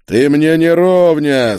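loud, high, drawn-out scorn; contemptuous. A narrator's reading. Duke dismisses the witch as no equal, contemptuous. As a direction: fling it out slowly with lofty disdain. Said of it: "с презрением отозвался герцог".

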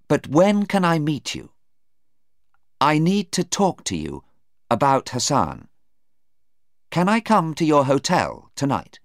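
a hint of an insistent Anna; Anna pressing. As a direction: press him for a meeting. Anna presses Stephen to meet.

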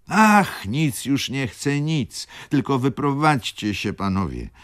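desperate exclamation. A narrator's reading. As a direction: cry out pleadingly, pitched well up. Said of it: "zawołała baronowa". (wysokie, błagalne wołanie baronowej)

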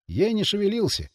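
ordinary delivery, nothing marked

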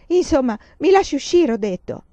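high, loud demand to be let out; shouting. Acted. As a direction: yell it, at the end of her rope. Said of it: "Ora gridava".

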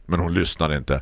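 usual speaking voice

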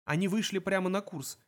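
ordinary delivery

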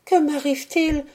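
high-pitched, stricken question; acted dismay, not hushed; devastated murmur. A narrator's reading. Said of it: "murmura Béatrix anéantie".

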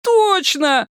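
high-pitched gleeful cry; jubilant. A narrator's reading. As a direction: crow it in delight. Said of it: "возликовал Мазур". (ликующий возглас)

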